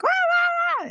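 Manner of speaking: high loud mock wailing; imitated child wail. Mom imitating her daughter's loud, high night scream.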